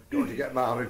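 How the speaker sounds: usual speaking voice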